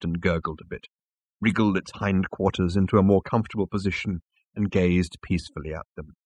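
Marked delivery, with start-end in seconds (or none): none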